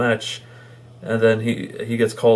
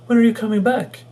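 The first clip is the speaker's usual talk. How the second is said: mock heroine; 12 semitones higher, 3 dB louder than usual. Quoting heroine's line, high.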